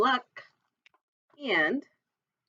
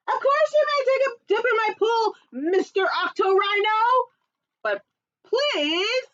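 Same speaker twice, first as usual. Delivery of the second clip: much higher, louder cheery lifeguard; 9 semitones higher, 3 dB louder than usual. Acted. Lifeguard welcomes Octorino, high and cheerful. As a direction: welcome brightly then plead.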